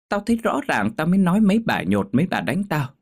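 high-pitched aggrieved self-defence; aggrieved, voice pushed up high; indignant protest. Acted. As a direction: protest your innocence hotly. Mother indignantly defends herself.

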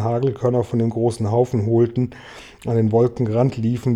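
ordinary delivery, nothing marked

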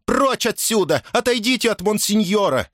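high, outraged orders; indignant command, pitched far up; lashing out. A narrator's reading. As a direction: drive the intruder off as a frantic housekeeper. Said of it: "накинулась на Сирену домоправительница".

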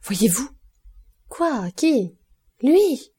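high gasped questions, rising in pitch and force; panicked disbelief. A narrator's reading. Steward sees the man he thought dead, panicked disbelief. Bertuccio panics on seeing Villefort.

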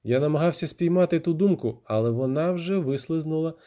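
usual speaking voice

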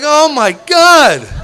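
loud, high, drawn-out amazement; retold astonishment. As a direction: stretch it out, overwhelmed by the applause. Acts his reaction to the cheering.

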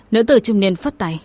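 plain reading voice

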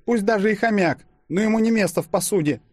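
high, firm waitress voice; resolute waitress. A narrator's reading. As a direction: state it boldly in a high voice. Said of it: "решительно заявила смелая официантка". (решительная официантка, высоким голосом)

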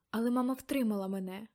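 ordinary delivery

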